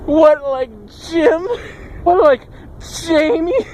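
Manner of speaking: high-pitched teasing disbelief; mock incredulity. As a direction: play someone cracking up at a silly name. Acting laughing interviewers mocking a name.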